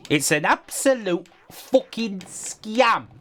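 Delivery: hammed-up slow outraged verdict; mock outrage. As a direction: stretch every word in disbelief.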